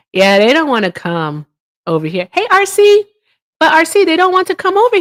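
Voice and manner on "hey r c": normal talking voice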